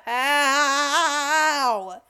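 hammed-up wail of amazement; exasperated disbelief. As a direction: stretch HOW into a long, loud cry. Mock despair at the singer's skill.

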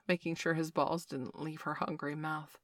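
plain reading voice

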